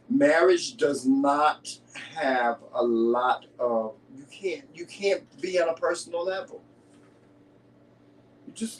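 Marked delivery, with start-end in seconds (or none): 0.1-3.9 slow and deliberate, word by word; very slow lecturing tone; slow emphasis. Real, not acted. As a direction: lay it down slowly like a rule.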